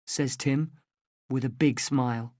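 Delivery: usual speaking voice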